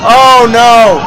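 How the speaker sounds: loud, pained "oh no!"; dismayed cry. Real, not acted. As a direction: cry out at the footage. Reacting to strikes on screen.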